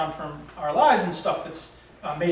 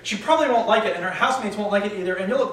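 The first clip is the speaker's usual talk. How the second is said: higher-pitched chastising voice in the head; heckling inner critic; 3 semitones higher, 3 dB louder than usual. Acted. Little hater chastising the guy on a date, heckling. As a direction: needle him as his own inner hater. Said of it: "The little hater started in again".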